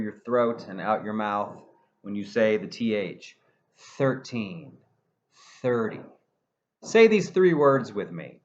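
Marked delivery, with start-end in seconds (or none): 4.0-6.0 "Thirteen" and "thirty" with a pure-air TH, no voice; teacher models "thirteen" and "thirty" with a breathy TH; unvoiced th. Shown as a demonstration. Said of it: "no voice".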